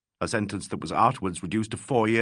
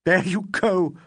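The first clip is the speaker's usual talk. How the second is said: high, louder, near tears; half crying; 9 semitones higher, 5 dB louder, slower than usual. Acted. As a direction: cry it out, on the edge of sobbing. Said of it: "cried Legg, half crying".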